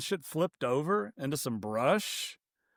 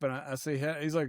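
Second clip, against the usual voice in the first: usual speaking voice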